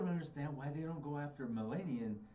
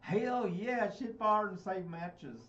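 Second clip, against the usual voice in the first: loud, high, slow southern drawl-style shout; hammed-up Southern accent, dragging the last words; mock southern accent; 7 semitones higher, 5 dB louder, much slower than usual. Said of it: "southern accent".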